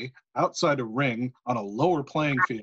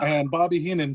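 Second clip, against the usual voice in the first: ordinary talk, nothing marked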